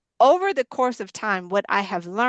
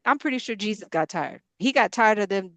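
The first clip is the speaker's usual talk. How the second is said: ordinary delivery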